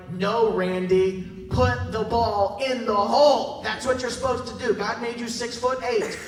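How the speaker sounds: slow, loud, drawn-out plea to a teammate; exasperated yell. Retold or imitated. Pastor yelling at tall teammate Randy to shoot, exasperated slow yell. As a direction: shout it at him like he's blowing the play. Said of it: "And I'm like".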